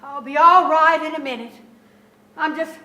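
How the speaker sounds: distraught outburst. Acted. Mother overcome after discovering failure.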